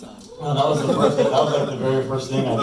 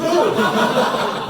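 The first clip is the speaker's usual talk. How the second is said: high-pitched burst of laughter; 7 dB louder than usual. Real, not acted. Comedian cracking up at his own joke, laughing loudly. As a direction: break into a loud high laugh.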